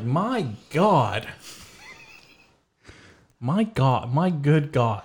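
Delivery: high, swooping mock dismay; mock shock. Shopper pretends someone else said it, mock shock. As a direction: exclaim as if scandalized by someone else. Acts shocked bystander to own outburst.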